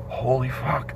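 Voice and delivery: softly muttered, stunned aside; imitating inmates' low, hushed shock; hushed dread. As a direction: say it under your breath, stunned. Frightened prisoners muttering in story.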